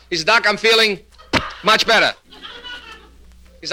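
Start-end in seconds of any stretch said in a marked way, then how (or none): 0.2-2.1 high voice, blocked mid-sentence; stuttering patient. Acted. Patient reports back to his doctor, halting stutter. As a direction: halt hard before the last words. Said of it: "a little impediment in his speech".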